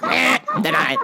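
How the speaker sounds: mock clerk voice. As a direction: blurt it like a mocking clerk. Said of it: "So they went".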